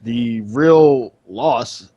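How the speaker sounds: ordinary delivery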